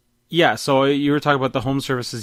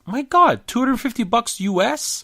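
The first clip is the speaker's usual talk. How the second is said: indignant outburst; 12 semitones higher, 4 dB louder than usual. Real, not acted. Outraged at the hardware price.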